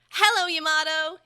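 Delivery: high, loud squeaky greeting; squeaky creature. A narrator's reading. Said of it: "it squeaked".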